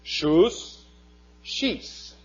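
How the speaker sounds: "shus" with a long OO, "shis" with a long EE; Hebrew word demo. Shown as a demonstration. Teacher listing child roots, each sounded out separately. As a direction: set "shus" and "shis" apart as single words. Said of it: "here we have the word".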